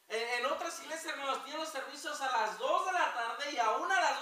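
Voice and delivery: loud, driving pulpit voice; preaching at full force. Acted. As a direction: hammer the warning home to the congregation. Prediger ermahnt die Gemeinde.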